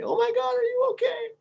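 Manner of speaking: very high, frantic mock scream; squealed, freaked-out falsetto; imitated panicked woman. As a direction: imitate a woman shrieking in alarm. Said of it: "heard a scream and this woman screaming".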